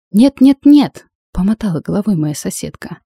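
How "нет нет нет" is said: high, loud, urgent refusal; loud alarmed no. A narrator's reading. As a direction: cry out to stop them. Roommate stops fight.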